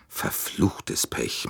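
slow, breathy grumble under the breath; annoyed muttered curse. A narrator's reading. Bouc vents his annoyance at the full train, muttered curse. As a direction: let the frustration out in a hushed curse. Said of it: "machte Monsieur Bouc seinem Ärger Luft".

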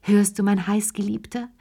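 plain reading voice

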